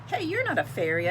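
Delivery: bright, loud exclamation of surprise; a child startled by a cricket, loud and surprised; surprised call. A narrator's reading.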